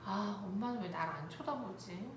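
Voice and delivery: soft, understated longing; quietly voiced inner wish of a child; child's wistful thought. Child sitting in cart wanting mom's look, wistful. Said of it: "아이의 마음은".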